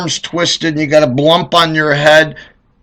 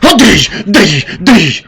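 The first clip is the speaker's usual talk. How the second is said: whacking noises; 4 semitones higher, 6 dB louder, much slower than usual. Retold or imitated. Imitated whacking sounds.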